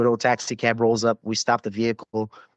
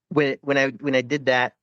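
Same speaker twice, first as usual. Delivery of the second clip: ordinary delivery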